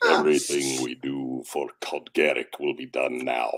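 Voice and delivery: low, put-on heavy voice; heavy character voice. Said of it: "pick a heavier one off character".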